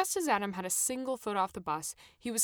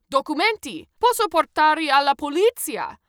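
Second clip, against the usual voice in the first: high, very loud Italian bellow; shouted threat; 8 semitones higher, 13 dB louder than usual. A narrator's reading. Footballer threatens passengers in Italian.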